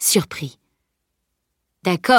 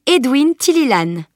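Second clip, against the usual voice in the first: higher, emphatic announcement of a name; declaring; 7 semitones higher, 4 dB louder than usual. A narrator's reading. Girl reveals the stranger's name to knight, declaring. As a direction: announce the name with pride of place. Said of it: "déclara-t-elle".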